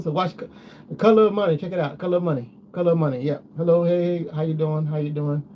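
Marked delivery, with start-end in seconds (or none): none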